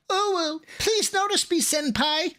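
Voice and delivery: put-on high squeaky anime girl plea; squeaky high cutesy senpai voice; breathy cutesy giggly. Shown as a demonstration. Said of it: "give us an uwu".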